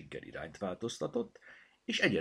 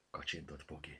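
plain reading voice

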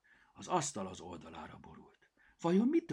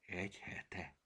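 whispered; 8 dB quieter, slower than usual. Acted. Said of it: "suttogta Cirmi".

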